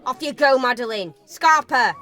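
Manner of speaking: shooing away. Acted. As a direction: send it scurrying off.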